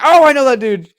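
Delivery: loud, high burst of recognition; excited recognition. Retold or imitated. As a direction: blurt it out, reenacting delighted surprise. Retells own reaction on recognizing actor.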